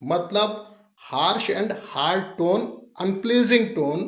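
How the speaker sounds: very slow, drawn-out words; slow teaching definition. Shown as a demonstration. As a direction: lay each word down slowly. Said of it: "teacher defining term slowly". (बहुत धीमी परिभाषा)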